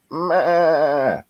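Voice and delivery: high bleating "ma"; sheep bleat. Retold or imitated. Mother sheep trying to say mine, high bleat. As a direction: bleat it like a lamb. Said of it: "all it can get out is".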